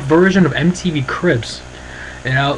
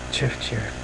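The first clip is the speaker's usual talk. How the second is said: much softer, muttered repeat of "Jeff Jarrett"; quiet echo; 4 semitones lower, 12 dB quieter than usual. Shown as a demonstration. The name keeps repeating in his head, in a much softer muttered echo. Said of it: "this is what happens".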